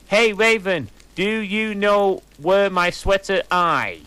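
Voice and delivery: put-on high, loud ditzy teen voice, slowed; mock author's note. Reader mocks the fanfic author's note to Raven. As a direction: ham up the clueless teen author.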